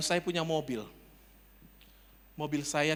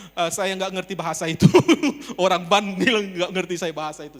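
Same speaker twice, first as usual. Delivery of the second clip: loud, laughing through the words; real laughter, raised voice; laughing exclamation; 4 semitones higher, 13 dB louder than usual. Real, not acted. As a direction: crack up while telling the crowd. Laughs at the landlord's answer.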